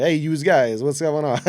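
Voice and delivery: put-on loud, brash Northern accent greeting. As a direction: belt it out in a thick Northern accent. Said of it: "this thick Northern accent at the time".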